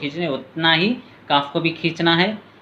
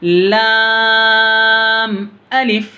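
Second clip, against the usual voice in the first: slow, high, louder chanted lam alif; drawn-out recitation; 6 semitones higher, 5 dB louder, much slower than usual. Shown as a demonstration. Teacher demonstrates the lesson's letters to pupils, slow chanted and louder. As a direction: hold लाम very long, then a falling अलिफ. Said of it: "इसको ज़्यादा खींचे".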